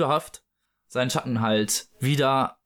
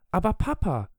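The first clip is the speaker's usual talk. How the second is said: put-on high-pitched child's protest; reproachful girl's cry; 7 semitones higher than usual. Wendy catches her father cheating, high reproachful cry. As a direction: call out high, falling away in reproach. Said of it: "Wendy rief".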